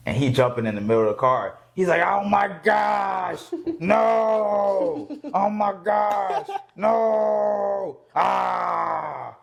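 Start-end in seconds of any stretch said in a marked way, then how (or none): 2.1-9.4 higher, slow shouted panic, voice cracking like a cry; mock panicked yell. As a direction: imitate a frantic man screaming over a car crash. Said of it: "He sounded like [name]".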